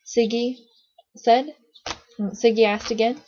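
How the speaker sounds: usual speaking voice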